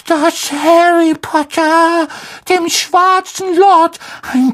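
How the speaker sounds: sehr hohe, langsame, eindringliche Elfenstimme; Hauself Dobby. Acted. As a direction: neugierig und ehrfürchtig vorgebeugt. Dobby voiced by narrator. (hoher, langsamer, eifriger Elf)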